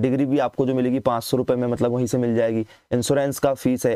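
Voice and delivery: normal talking voice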